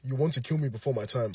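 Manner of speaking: low, soft mock-mom complaint; Nigerian mom line. Mom whose child came home late, low weary scold. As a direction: mutter it like a wounded mother. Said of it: "your mom has probably said this line".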